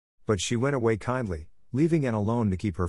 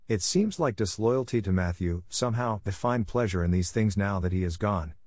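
plain reading voice

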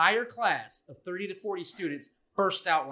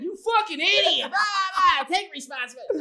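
much higher, louder rant; mock scolding, hammed up; imagined telling off; 12 semitones higher, 6 dB louder than usual.

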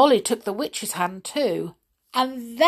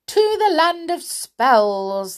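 high, slow, loud command; 8 semitones higher, 5 dB louder, slower than usual. Acted. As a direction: order the chair to fly. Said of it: "the witch commanded".

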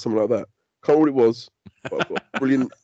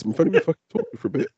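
normal talking voice

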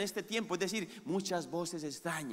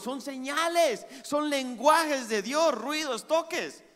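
preaching at full force; 7 semitones higher, 6 dB louder than usual. Acted. Pastor preaching to the congregation about God's voice, full force. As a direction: lift the congregation with the promise.

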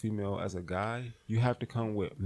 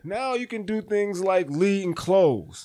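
much higher and louder, hyped; 10 semitones higher, 10 dB louder than usual. Real, not acted. Pumped up and teaching friends how to approach women, excited and loud.

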